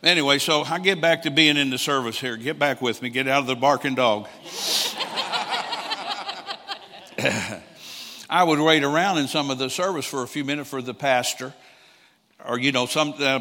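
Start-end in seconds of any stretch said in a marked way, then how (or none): 4.3-7.6 real laugh breaking up high; long high laugh. Real, not acted. Preacher laughing at his own joke, a long high laugh.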